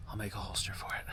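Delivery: soft unvoiced aside; whispered aside. Real, not acted. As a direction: whisper it to yourself as a joke. Quiet aside after joke.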